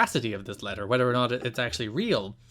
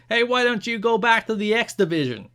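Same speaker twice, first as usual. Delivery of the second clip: higher cartoon New Jersey voice for Jarrett; mock Joey accent; 9 semitones higher, 4 dB louder than usual. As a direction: sneer it in a goofy Jersey accent.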